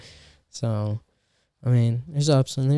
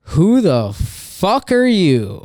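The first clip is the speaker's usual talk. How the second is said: slow, loud, higher incredulous retort; comic on stage, baffled at a huge stranger; 6 semitones higher, 6 dB louder, slower than usual. Retold or imitated. Comic eyeing a man walking up, loud and incredulous. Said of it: "I'm like".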